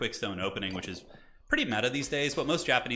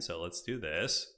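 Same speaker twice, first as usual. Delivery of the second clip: normal talking voice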